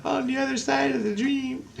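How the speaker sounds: put-on soft, high-pitched cute child voice; small high voice repeating solemn words; imitating his kid. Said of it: "It's so cute".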